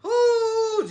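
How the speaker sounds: high startled ooh; surprised cry. Real, not acted. Frustrated by a frozen live feed, high startled yelp. Live stream connection drops.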